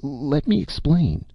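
high-pitched, drawn-out plea; exclaimed plea. A narrator's reading. Narrator begs Madeline before she goes, exclaimed plea. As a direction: plead slowly for a chance to speak. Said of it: "I exclaimed".

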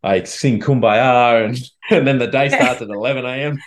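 hammed-up spiritual guru voice; mock guru. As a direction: parody an over-the-top wellness guru. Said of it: "I wasn't like".

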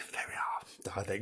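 hushed unvoiced whisper. Host whispering the Welsh episode number to listeners. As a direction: breathe it like a secret.

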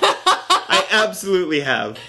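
laughing, stressing I. Real, not acted. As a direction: insist on I while laughing. Laughing insistence repeated.

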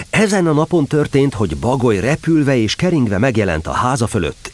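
steady storyteller's reading voice; story narration. A narrator's reading. Narrator reading Winnie-the-Pooh to children, gentle storytelling. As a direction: read it like a bedtime story.

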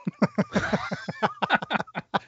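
high, breathy burst of laughter at his own punchline; cracking up at his own joke. Real, not acted.

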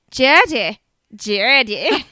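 loud, drawn-out "Jurdy"; exaggerated mispronunciation. Shown as a demonstration. Host mocking own slip of co-host's name, exaggerated mispronunciation. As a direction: overdo the slipped vowel in "Jurdy", breaking into laughter.

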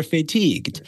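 playfully adopt "fay" in "fatigued"; "fatigued" pronounced fay-teegd; borrowed French pronunciation. Retold or imitated. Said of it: "it's the French pronunciation".

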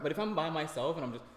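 ordinary talk, nothing marked

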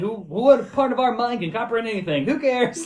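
breezy, dismissive, pitch up; carefree stoner shrug. Retold or imitated. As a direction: toss it off like nothing matters. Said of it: "he's like".

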